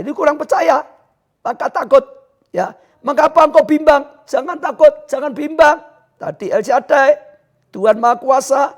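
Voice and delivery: loud, high-pitched sermon delivery; full-force preaching. Real, not acted. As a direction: preach at the top of your voice. (khotbah berapi-api)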